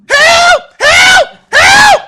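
high, full-force screams for help; loudest cry. Acted. Disciples crying out at a supposed ghost, loud terrified cry. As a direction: shriek it in panic. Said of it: "who cried the loudest".

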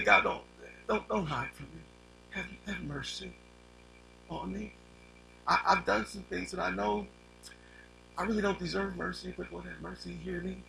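hushed prayer. Retold or imitated. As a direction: pray it quietly, almost to yourself. Preacher acts his own quiet prayer.

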